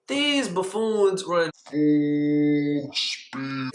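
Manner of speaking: words stretched way out; drawn-out exasperation. Retold or imitated. Telling how friends charged the car, hugely stretched. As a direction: drag out the words for dramatic build.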